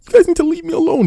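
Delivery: hammed-up whiny protest; mocking whiny streamer. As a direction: squeal it like a sulking kid. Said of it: "trying to be like".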